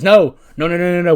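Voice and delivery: loud, high string of noes; panicked denial. Acted. Manny backtracking after confessing love, in a panicked denial. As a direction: blurt out a flustered mock denial.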